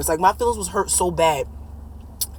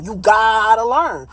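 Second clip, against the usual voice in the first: slow, firm repeat; 2 semitones higher, much slower than usual. Real, not acted.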